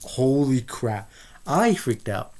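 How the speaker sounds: drawn-out startled exclamation, slow; mock freaked out. Heroine sees therapist smiling, slow mock fright. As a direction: stretch it like the scare just landed.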